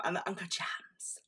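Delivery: unvoiced breathy imitation of Julia Fox; whispered mimic. Vlogger imitating Julia Fox's famous line, whispered. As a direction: whisper it the way she said it.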